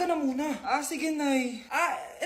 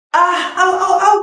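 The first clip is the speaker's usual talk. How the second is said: mock pain; 2 semitones higher, 12 dB louder than usual. Friend hurts foot after walking.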